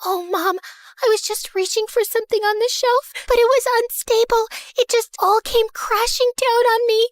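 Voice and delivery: whiny victim. A narrator's reading. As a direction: play the wounded innocent to her mom.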